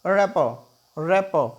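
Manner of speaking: ordinary talk, nothing marked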